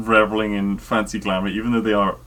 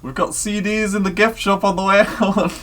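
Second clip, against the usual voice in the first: much higher, louder mock voice; Monty Python quote; 11 semitones higher, 4 dB louder than usual. Telling a friend about the gift shop gag, high mock voice. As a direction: squeak it out like a cheeky sketch character.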